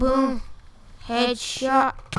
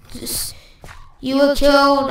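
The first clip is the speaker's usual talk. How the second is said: ordinary delivery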